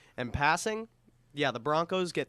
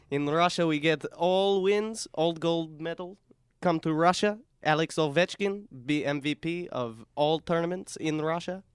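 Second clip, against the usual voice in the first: bold mock Russian accent, louder and higher; 4 semitones higher, 4 dB louder than usual. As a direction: boast like a Russian strongman in a thick accent. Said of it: "That's a really good Russian accent".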